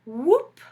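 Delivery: put-on loud rising whoop repeated for the kids. Shown as a demonstration. Teacher repeating the sound for the class, loud whoop. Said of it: "pretty good onomatopoeias".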